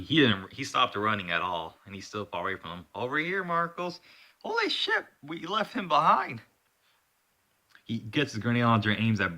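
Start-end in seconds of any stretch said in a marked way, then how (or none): 2.9-4.0 calling out. Acted. Character calls out from afar. 4.4-6.4 high-pitched panicked exclamation; alarmed outcry. Acted. Acted panic in action story.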